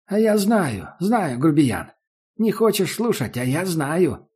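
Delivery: high, sing-song sulky crone; old woman voice. Acted. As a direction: tease like a grumpy old hag. Barge woman sulking, teasing.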